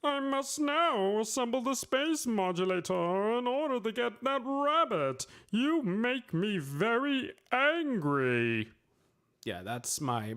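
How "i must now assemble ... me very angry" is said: much higher cartoon alien voice, slower on the angry line; Marvin the Martian impression. Streamer showing off his Marvin the Martian voice, high and prim. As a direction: play the prim little Martian, deliberate. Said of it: "I can do a Marvin the Martian impression".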